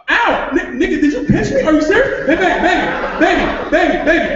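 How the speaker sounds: high, startled yelp into pleading; yelp, then scramble to placate; pained outburst. Acted. Father pinched mid-repair by his partner, startled high outburst.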